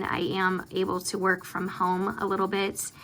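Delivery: normal talking voice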